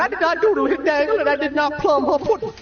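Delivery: high, fast Cosby scat; Bill Cosby impression. Bill Cosby denying it to the priest, high and fast. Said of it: "counseling Bill Cosby".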